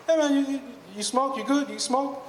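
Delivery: high-pitched, insistent hustler pitch; Jamaican hustler. Retold or imitated. Jamaican guy approaching a tourist again, high, pestering mock voice. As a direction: imitate a pushy little Jamaican guy, voice way up. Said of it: "I see the Jamaican guy again".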